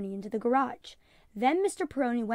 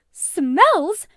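alarmed; 7 semitones higher, 14 dB louder than usual. Acted. Mother alarmed by the snake.

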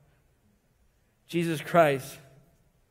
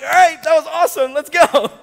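laughing, high and loud, ready to go; excited; 8 semitones higher, 9 dB louder than usual. Retold or imitated. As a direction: blurt it out with a laugh, eager to move on. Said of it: "I was like".

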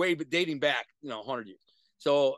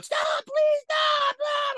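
very high, loud frantic woman's scream; imitating a girlfriend screaming stop; girlfriend pleading; 7 dB louder, much slower than usual. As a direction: shriek it like a terrified girlfriend. Girlfriend begging, voiced.

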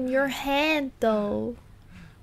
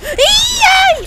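high, loud cheer; 11 dB louder than usual. Real, not acted. Player cheering a win, real loud cheer. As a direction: shout it in triumph.

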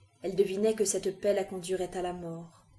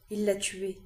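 usual speaking voice

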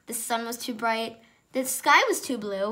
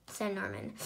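usual speaking voice

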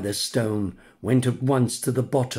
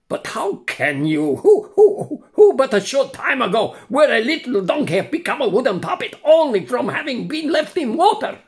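high, loud, stuttering disbelief; can't make sense of it; bewildered buyer; 12 semitones higher, 6 dB louder than usual. Acted. The buyer asks how a donkey became a puppet, bewildered.

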